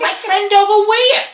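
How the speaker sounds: loud, high, drawn-out mimicry; mock echo in character. [name] answers the newcomer at the party, loud mock echo. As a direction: throw it back in an exaggerated voice.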